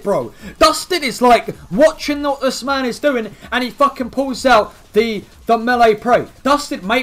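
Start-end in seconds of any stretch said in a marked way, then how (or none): none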